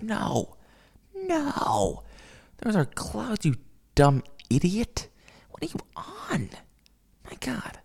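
high-pitched, exasperated squeal; mock exasperation. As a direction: correct a fool in a high, despairing voice. Acted exasperated correction of her.

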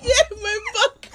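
high, slow words through laughing; high-pitched, laughed-out exclamation; helpless laughter. Real, not acted. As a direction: gasp it out while cracking up.

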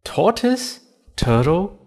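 high, loud baffled questions; puzzled bunny. Acted. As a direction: ask it all in bewilderment. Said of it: "said Banana the bunny".

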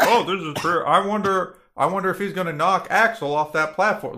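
mock dumb American. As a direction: play a gawking fan at the gladiators. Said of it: "Americans are like".

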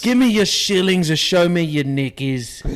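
loud, slow hammed-up pirate Cockney; British pirate accent. Friend robbing a lady in a loud hammed-up pirate accent. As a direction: roll it out like a pirate robber. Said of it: "Pirates of the Caribbean English".

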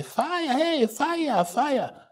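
high, limp cry of fire; a half-hearted high fire alarm; mock high calm call of fire. Shown as a demonstration. Preacher mocking a weak call of fire, high and limp. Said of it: "you don't say".

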